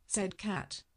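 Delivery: ordinary delivery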